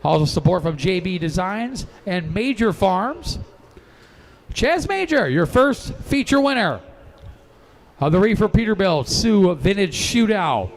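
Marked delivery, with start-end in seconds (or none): 4.5-10.7 excited winner announcement. Real, not acted. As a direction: boom out the winner's name.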